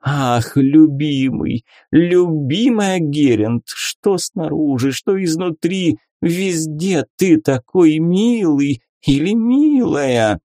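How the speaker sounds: high, slow gushing slime croon; gloopy bubbling slime voice. A narrator's reading. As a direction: coo it like a lovesick blob. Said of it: "странный булькающий голос".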